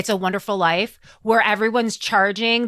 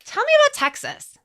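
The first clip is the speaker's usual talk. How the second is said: much higher playful prompt; mock eager. Co-host prompts her partner, high and playful.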